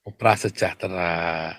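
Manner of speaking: soft, low, drawn-out euphemism; mock-delicate, hushed correction. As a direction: say it slowly and delicately, imitating a polite evader.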